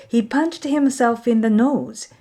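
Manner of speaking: ordinary delivery, nothing marked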